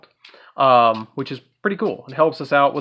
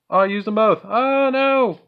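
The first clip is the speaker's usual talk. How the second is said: high wail of dismay, climbing higher; 8 semitones higher, 4 dB louder, slower than usual. Real, not acted. Player realising both cards are spent, dismayed wail. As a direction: lament the blunder, pitch soaring.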